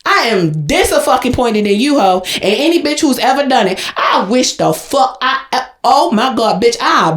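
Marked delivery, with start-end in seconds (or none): none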